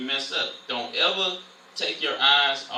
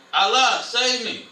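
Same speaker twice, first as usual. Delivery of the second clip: loud, higher mock plea; mock cry for help; 7 semitones higher, 7 dB louder than usual. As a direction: cry out in pretend panic. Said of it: "If he would have called upon".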